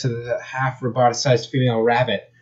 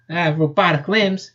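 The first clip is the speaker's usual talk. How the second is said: higher southern voice with a snicker; 6 semitones higher than usual. Acted. Rabbit amused by her robotic limbs, snickering. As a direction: snicker through the words. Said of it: "she snickered".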